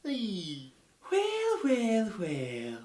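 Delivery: very high, drawn-out well well well; mock a smug cartoon character; character impression. Impressions video, a high drawn-out cartoon voice. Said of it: "do some impressions".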